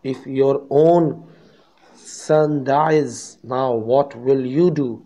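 loud, slow furious challenge; angry father. Acted. Father confronting the calm doctor, loud and angry. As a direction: demand an answer, heated and loud. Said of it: "said the father angrily".

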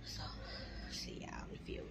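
whispering. Real, not acted. Signing off quietly in the lobby.

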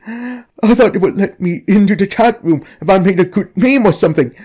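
pleading, remorseful poster begging for help; distraught guilty confessor. Acted. Distraught confession read in character.

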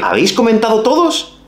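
high rising mock question; ironic question. Shown as a demonstration. Teacher teasingly quizzing students about comments, high ironic question. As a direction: ask it pointedly high. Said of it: "No es lo mismo decir".

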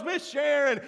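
loud, high Cajun exasperation; Cajun accent. Retold or imitated. Pastor imitates Cajun man's exasperated reply, Cajun accent. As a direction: call her name in mock frustration. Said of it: "because of his accent".

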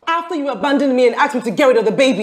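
angry retort. Acted. Woman throwing blame back at her lover, angry retort. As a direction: fire the blame back at him.

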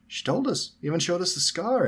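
put-on high, eager boy's report; excited. A narrator's reading. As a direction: blurt out the news with excitement. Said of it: "answered Fred excitedly".

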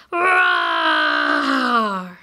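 low, loud roaring lion. Pretend. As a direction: roar it out like a lion. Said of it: "Can we roar like a lion?".

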